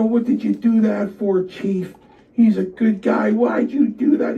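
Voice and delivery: very high, loud-edged challenge; retold indignant protest. Wrestler challenges Chief about Joe, heated high-pitched protest. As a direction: act out demanding an explanation. Acted argument with boss, retold.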